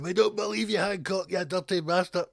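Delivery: mocking crowd heckle; mock jeer. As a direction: heckle him with contempt. Public jeering at minister.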